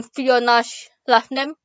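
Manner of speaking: ordinary delivery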